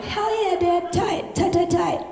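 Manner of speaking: pumped-up cheer for the dad; excited shouting. Retold or imitated. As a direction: cheer it on like a bro. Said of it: "I'm like".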